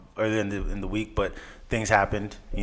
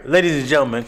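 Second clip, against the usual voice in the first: announcer voice; 2 semitones higher, 5 dB louder than usual. Acted. Third announcer opening.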